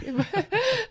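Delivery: a sudden high burst of laughter; short real laugh; bright laugh. Real, not acted. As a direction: laugh at your own story. Laughs at own story.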